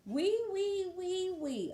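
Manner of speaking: high thin mosquito whine; high buzzing wee-wee of a mosquito. Acted. Mosquito sound in story.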